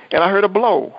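ordinary delivery

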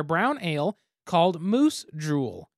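slow, drawn-out name; savoring "Moose Drool" word by word; said slowly. Shown as a demonstration. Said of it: "That's a weird word to try to say slowly".

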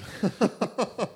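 a high burst of laughter; real amused laugh. Real, not acted. Laughs at own joke.

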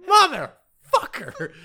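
high, loud, drawn-out scream of rage; howling curse, slow and very loud; offscreen scream. Retold or imitated. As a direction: scream it long from far off. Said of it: "a scream off screen from Matt Fuhrer going".

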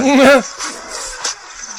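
high burst of laughter; loud laugh. Real, not acted. Reacting to cow video.

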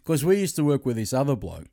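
ordinary talk, nothing marked

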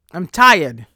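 "I'm tired" belted out in exasperation. Real, not acted.

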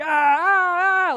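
high, loud mock screaming. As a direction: imitate a shrieking kid in pain. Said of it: "that I'm screaming".

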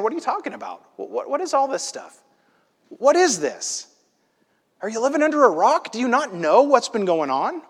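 high, exasperated mock disbelief; frustrated. Frustrated traveler to the stranger who just showed up, mock exasperation. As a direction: imitate someone fed up with clueless questions. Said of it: "in this kind of frustrated state".

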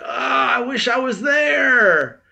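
high, drawn-out mock wail; hammed-up wishing. Telling of his comment on a pool-party post, hammed-up wishing.

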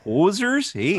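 put-on high, questioning Canadian accent. Host plays with the slang in a very Canadian accent. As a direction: toss it out in a mock Canadian voice. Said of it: "that's very Canadian".

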